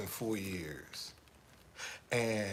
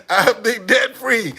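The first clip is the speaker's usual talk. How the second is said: high, loud and slow gleeful boast; gleeful boast about money; 9 semitones higher, 14 dB louder, slower than usual. Real, not acted. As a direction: savor every word. Gloating punchline.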